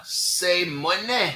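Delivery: stretched, drawn-out mock Irish accent; fake Irish-Scottish accent. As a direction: say it slowly in a joke Scottish brogue. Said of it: "Irish accent or my Scottish accent".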